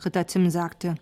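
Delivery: plain reading voice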